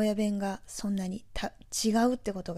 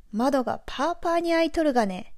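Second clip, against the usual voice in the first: higher, louder Nagoya dialect example; native Nagoya dialect; 5 semitones higher, 4 dB louder than usual. Shown as a demonstration. Native speaker giving listeners an example sentence, Nagoya dialect. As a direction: demonstrate a homegrown Nagoya accent, pitched up. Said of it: "ネイティブ名古屋弁で例文を作るとしたら".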